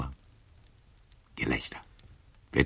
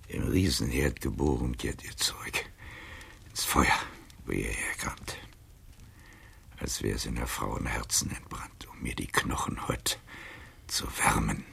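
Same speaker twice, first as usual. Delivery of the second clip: whispered farewell; 3 semitones lower, 5 dB quieter than usual. Acted. Burning his love letters, murmuring.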